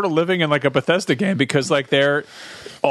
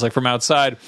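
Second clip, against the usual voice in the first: usual speaking voice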